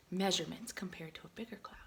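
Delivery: quick soft whisper; whispered aside. Real, not acted. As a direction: rush it under your breath. Whispered asides pointing at page.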